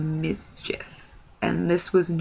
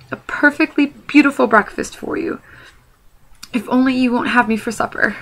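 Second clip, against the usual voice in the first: higher, louder frightened begging; pleading hobbit; 6 semitones higher, 3 dB louder than usual. Acted. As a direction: beg for your life, eager to please. Bilbo begs the trolls.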